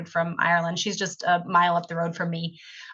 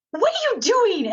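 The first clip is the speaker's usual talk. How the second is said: high, curious question; curious stranger; 14 semitones higher than usual. Retold or imitated. Artist asking Cindy in the store, high and curious. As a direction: stranger stopping her in Trader Joe's, high and curious.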